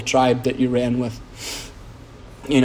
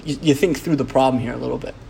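ordinary talk

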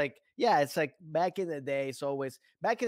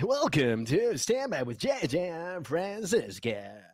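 slow radio host announcement; 4 semitones higher, slower than usual. Shown as a demonstration. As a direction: stretch it out like a DJ. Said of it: "no, my D J is like".

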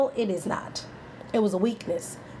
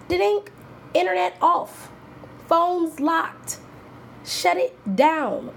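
playful lockdown; 9 semitones higher, much slower than usual. Pretend. Parent cutting off phones playfully.

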